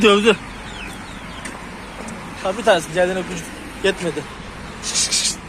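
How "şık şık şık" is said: whispered shoo sounds to herd chicks; shooing hiss. Real, not acted. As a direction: hiss them along, no voice. (fısıltıyla kışkışlayarak)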